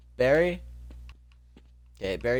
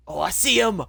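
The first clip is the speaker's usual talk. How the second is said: excited; 8 semitones higher, 7 dB louder than usual. Real, not acted. Spots the rat.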